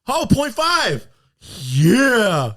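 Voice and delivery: loud, high celebratory whoop; triumphant. Real, not acted. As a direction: draw out the victory cry. Celebrates his rating guess.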